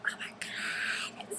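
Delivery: breathless whispered oh my god; slow, hushed awe; stunned whisper. Retold or imitated. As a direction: whisper it, too starstruck to speak. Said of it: "and I was like".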